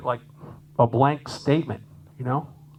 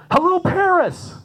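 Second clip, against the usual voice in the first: gushing stage greeting; 14 semitones higher, 3 dB louder than usual. Retold or imitated. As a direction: ham up a singer greeting the crowd. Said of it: "she started going".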